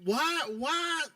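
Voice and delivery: high, exasperated plea to calm down; exasperated outburst. Real, not acted. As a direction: throw your hands up in disbelief, then drag it out slowly. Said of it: "you just went clean slap off".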